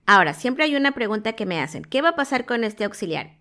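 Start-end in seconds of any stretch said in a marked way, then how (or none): none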